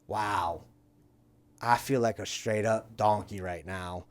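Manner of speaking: low, soft talking to himself; sheepish realisation. Retold or imitated. Storyteller finding the hidden tubes, low sheepish realisation. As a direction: drop right down, quietly humbled.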